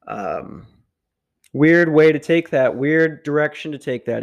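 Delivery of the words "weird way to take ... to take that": shouted, half-laughing outburst; loud exclamation. Real, not acted. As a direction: blurt it out loud. Exclaiming at his own tangent.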